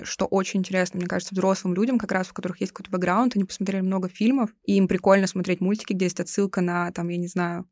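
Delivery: normal talking voice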